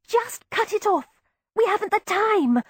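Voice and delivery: high, hurried urging; a high, pressing plea; urgent. A narrator's reading. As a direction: push her to act now. Girl urging haste.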